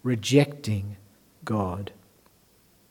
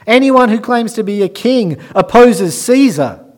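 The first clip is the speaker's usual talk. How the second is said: high, loud crowd shout at Pilate; leaders shouting; 9 semitones higher, 11 dB louder than usual. Acted. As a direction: shout it like an angry mob.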